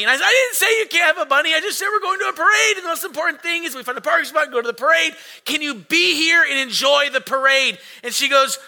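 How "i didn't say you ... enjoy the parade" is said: high, fast, loud arguing; hammed-up flustered parent rattling out explanations; exasperated dad. Dad reasoning with crying daughter, frantic exasperation.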